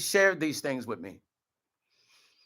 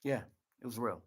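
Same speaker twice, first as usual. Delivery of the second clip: choked up; 6 semitones lower, 14 dB quieter, faster than usual. Real, not acted. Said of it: "I get emotional".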